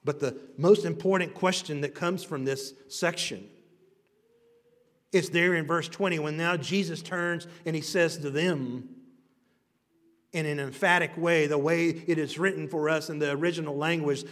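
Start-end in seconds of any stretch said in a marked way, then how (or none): none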